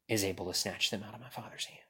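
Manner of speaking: hushed, breathless scripture close; whisper. Real, not acted. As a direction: whisper the promise to the church. Scripture climax, dropped to whisper.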